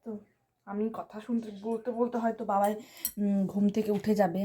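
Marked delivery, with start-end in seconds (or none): none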